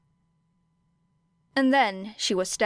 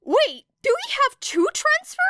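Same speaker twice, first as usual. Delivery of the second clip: surprised classmate; 4 dB louder than usual. Acted.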